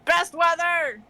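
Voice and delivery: high, gleeful call from outside; acted delighted cry. Character outside in the rain, heard calling out delightedly. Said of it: "Josh is like".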